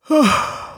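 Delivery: long drawn-out bored sigh; weary sigh. Acted. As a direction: sigh like you can't be bothered. Lazy neighbour sighs.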